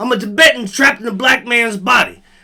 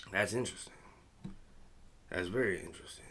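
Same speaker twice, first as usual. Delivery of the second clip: unvoiced, softer wry whisper; sarcastic whisper; 13 semitones lower, 20 dB quieter, faster than usual. Real, not acted. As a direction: whisper it like you don't buy it. Sarcastic aside on the site.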